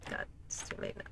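whispered under the breath; a hushed aside to yourself. Real, not acted. Muttering to self in dark.

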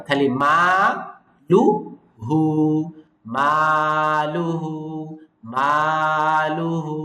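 মা লু হু split syllable by syllable; syllable drill. Shown as a demonstration. Teacher drills learners, syllable by syllable. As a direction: beat out each syllable slowly. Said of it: "এখানে টান আছে মাতে আর হুতে".

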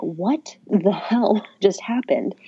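slow, stunned disbelief with a laugh; retold shock. Everyone reacting to what happened, retold shock. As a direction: imitate stunned onlookers, stretching the words out. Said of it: "everyone was just shocked at what happened".

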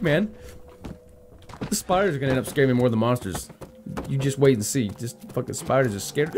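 ordinary delivery

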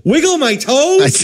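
high, drawn-out incredulous echo; outraged disbelief. Retold or imitated. Patient to doctor mid-procedure, incredulous. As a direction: throw it back high, in mock outrage.

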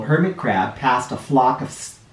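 usual speaking voice

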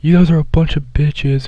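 a hint of a snotty girl tossing an insult; mocking girl voice. Voicing a girl insulting them.

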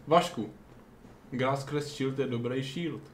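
ordinary delivery

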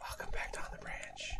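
very soft whispered welcome; whispering. Pretend. Host welcoming listeners to the show, whispering. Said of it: "that's why we're whispering".

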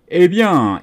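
high, loud opener; lifted, energetic cue; eager explanation. Acted. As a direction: launch keenly into explaining. Griffon launches eager explanation.

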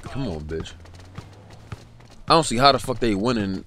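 normal talking voice

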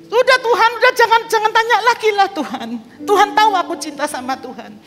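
high, loud, exasperated protest; annoyed pleading. Retold or imitated. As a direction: beg him to stop asking, irritated. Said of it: "Saya mulai jengkel sama Tuhan".

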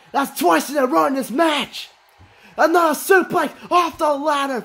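high excited call. Real, not acted. Second ladder suplex.

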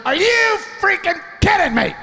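high, screeched mock outrage; hammed-up angry shriek; imitated furious wife. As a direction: impersonate an enraged wife yelling across a room. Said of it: "all of a sudden I hear".

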